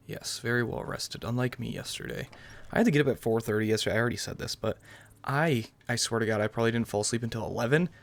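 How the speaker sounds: normal talking voice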